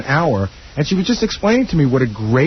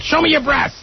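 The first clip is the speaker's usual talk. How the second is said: mock Gilbert line; 12 semitones higher than usual. Gilbert voiced hitting on fans.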